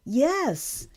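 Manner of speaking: high, loud happy confirmation; delighted. A narrator's reading. Daughter confirming the good news to father, delighted. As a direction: confirm it with lifted excitement.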